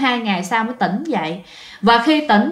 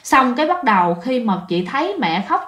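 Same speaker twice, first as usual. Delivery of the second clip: normal talking voice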